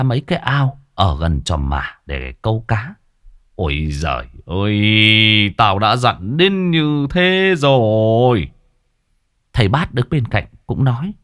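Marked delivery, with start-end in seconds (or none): 3.5-8.5 slow lament. A narrator's reading. Old man laments warning ignored.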